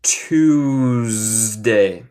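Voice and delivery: "Tuesday" with a buzzing z like a bee; z sound in Tuesday. Shown as a demonstration. Teacher shows students the buzzing z sound. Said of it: "Los dos de los S suenan más como abeja".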